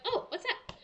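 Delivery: high, curious "what's that?"; suddenly wondering what's on your arm; puzzled surprise. Retold or imitated. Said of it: "So I'm like".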